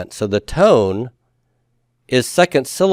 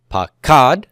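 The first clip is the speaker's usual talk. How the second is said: pakad slow, with KAD drawn out and stressed; pa-KAD, pakad with heavy second syllable; 3 semitones higher than usual. Shown as a demonstration. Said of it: "try to stress root two".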